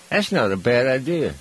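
ordinary talk

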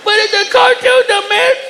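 put-on very high, singsong kid voice; very high wailing child protest; scared child. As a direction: argue like a frightened toddler.

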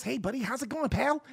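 lightly voiced chummy suburban guy; a hint of a friendly suburban buddy. Mocking how you talk to fit in, lightly chummy. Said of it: "you can talk like this".

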